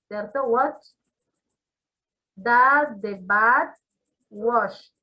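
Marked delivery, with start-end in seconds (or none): 2.4-4.9 That the bat washed, very slow, word by word; slow drill delivery; slow, careful. Shown as a demonstration. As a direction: spell out each word slowly for the class. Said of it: "tiene que exagerar ese sonido de esa T".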